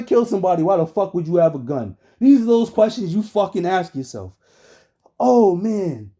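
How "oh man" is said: normal talking voice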